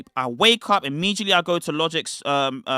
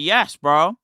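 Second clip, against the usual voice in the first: short, emphatic excited self-quote; retold excitement; 4 dB louder than usual. Reliving his eager agreement for viewers, mildly excited. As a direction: land it as a keen yes. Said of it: "I was very excited".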